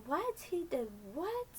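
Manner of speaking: shrill retold shock; shocked disbelief. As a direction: gasp the what, then drag the rest out. Old lady shocked again.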